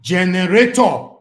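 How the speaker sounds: angry shout. Real, not acted. Vlogger to doubters on Facebook, a slow furious shout. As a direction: hammer the word home slowly. Ranting at critics about generator.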